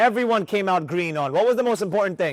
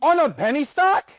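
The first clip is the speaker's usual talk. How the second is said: higher-pitched disbelieving question; incredulous; 6 semitones higher than usual. Real, not acted. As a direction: ask it in real disbelief, pitch climbing. Incredulous at student's indicator use.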